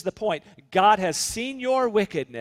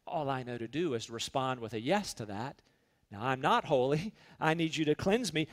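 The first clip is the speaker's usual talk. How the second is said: soft, humble murmured prayer; hushed prayer; 6 semitones lower, 8 dB quieter than usual. Acted. Believer answering God in a soft, humble prayer. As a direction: pray it quietly, as if confessing.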